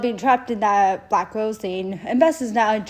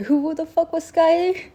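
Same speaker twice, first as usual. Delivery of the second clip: much higher, incredulous outburst; baffled; 9 semitones higher, 3 dB louder than usual. Retold or imitated. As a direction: squeak it out in disbelief. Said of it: "Jace was like".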